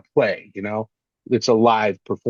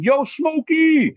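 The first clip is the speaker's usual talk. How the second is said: much higher, slow, stretched-out call across the street; Hector calling out; much slower than usual. Retold or imitated. Hector calling to Smokey from his lowrider, high slow call. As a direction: hail your buddy from a lowrider, high and slow. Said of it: "Hector is the guy who drives a lowrider".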